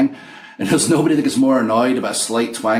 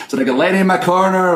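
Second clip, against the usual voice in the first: laughing; 10 semitones higher, 6 dB louder than usual. Real, not acted.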